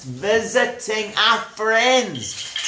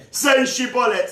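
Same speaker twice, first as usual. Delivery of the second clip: higher, barked test command; testing guard; 6 semitones higher than usual. Acted. Guards test people at the river, raised command. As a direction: demand the password in a raised voice. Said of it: "how they used to test them".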